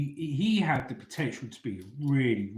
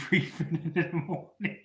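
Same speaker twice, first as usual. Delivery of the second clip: laughing; 6 semitones higher than usual. Real, not acted. Laughing at the memory.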